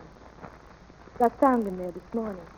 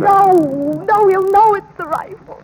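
much louder and higher, pleading; wife crying out to stop the shooting; anguished protest; 10 semitones higher, 13 dB louder, slower than usual. Acted. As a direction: cry out against him.

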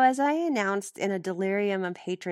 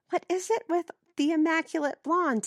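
exasperated; 10 semitones higher than usual. Acted. Narrator's exasperated inner aside.

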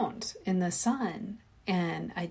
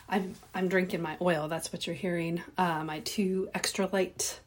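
ordinary talk, nothing marked

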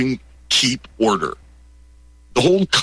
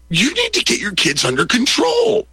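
much higher, indignant stranger telling off the dad; hammed-up angry stranger; mock scolding stranger; 14 semitones higher than usual. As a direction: play the offended bystander.

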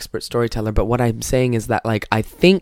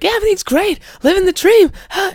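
much higher, chirpy upbeat voice; fake positive. Always-positive coworker brushing off the question, chirpy. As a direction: oversell how great life is. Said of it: "so everyday positive".

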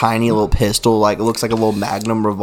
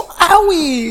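high squeal. Pretend. Mock pain squeal.

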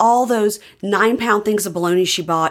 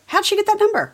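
high, puzzled question. Real, not acted. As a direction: ask it genuinely baffled, pitched way up. Wondering aloud, puzzled.

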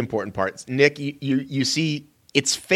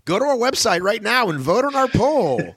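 radio promo voice; 12 semitones higher than usual. Pretend. Mock radio promo read.

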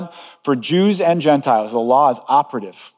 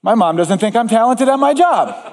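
high-pitched comic grievance; mock hurt; 7 semitones higher than usual. Preacher voices what we hear from mom, mock hurt. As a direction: act out a wounded child's conclusion. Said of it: "what do you and I hear?".

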